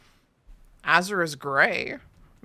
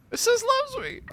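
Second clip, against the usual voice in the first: squeaky hopeful voice. Pretend. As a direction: squeal it like a smitten girl. Petal game alternating voices.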